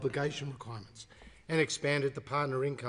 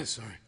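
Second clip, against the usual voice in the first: chesty cough; 4 semitones lower, 5 dB quieter than usual. Real, not acted. Coughing fit during speech.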